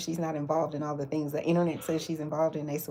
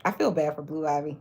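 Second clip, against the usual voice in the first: ordinary talk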